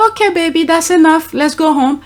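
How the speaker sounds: put-on sweet to a child. Shown as a demonstration. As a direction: coax the little one sweetly. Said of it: "You can say".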